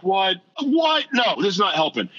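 sudden high shout of confusion; baffled outburst. Retold or imitated. Reading the Bible in a jail cell, baffled shout. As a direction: blurt it out, totally lost and fed up.

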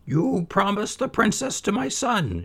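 put-on high old mother's voice, dismissive. A narrator's reading. Mother doubting her son's tale, high and dismissive.

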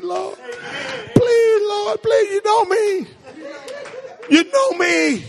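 rising, pleading cry to the Lord, breaking into laughter; begging sinner. Acted. Preacher acting out a sinner begging God for mercy, rising desperate plea.

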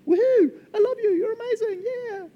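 very high, loud, crying with joy; overjoyed squeal. Retold or imitated. Barista overcome by a kind customer, squealing high. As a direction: squeal like you might cry with happiness. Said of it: "I'm just like".

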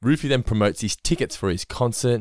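usual speaking voice

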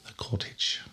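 hushed mock British accent. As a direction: say it softly in put-on British. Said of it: "used up all my British accent on that one word".

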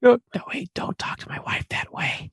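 hushed warning; aggressive whisper. Acted. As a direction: whisper a confrontation, defending a spouse. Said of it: "super aggressive and finally the whispering".

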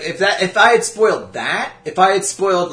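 normal talking voice

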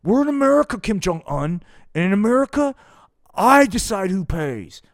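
high, loud puppet Nixon bellow; shouted high cartoon Nixon. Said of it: "Dick Nixon's like".

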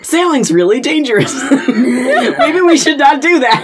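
high, loud, through laughter; laughing. Real, not acted. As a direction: joke it out while laughing. Joking aside through laughter.

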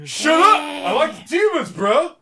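hammed-up macho protest; mock bro shout. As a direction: bark it like an insecure jock fan. Mocking insecure straight wrestling fan.

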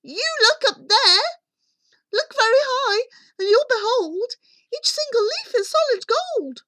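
put-on high, loud excited boy; boy crying out. A narrator's reading. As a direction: call out the discovery as young Jack. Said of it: "cried Jack".

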